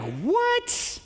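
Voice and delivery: What leaps very high in disbelief; stunned what. Retold or imitated. Friend does something dumb and you react, very high shocked What. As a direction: react to a friend's stupid move. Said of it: "you're like".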